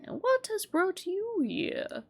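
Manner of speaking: calm, slow wise master; a lightly voiced old sensei.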